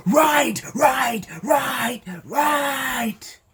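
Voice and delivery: exasperated shout. Retold or imitated. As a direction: snap repeatedly to shut him up. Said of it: "and the examiner goes".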